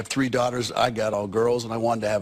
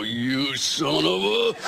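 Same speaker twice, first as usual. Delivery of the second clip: mock angry cursing; 2 semitones higher, much slower than usual. Acts out Annie attacking the heckler.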